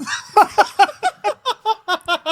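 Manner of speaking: a burst of high delighted laughter. Real, not acted. Son laughing at his dad's nonchalance, a high burst. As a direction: laugh out loud in disbelief.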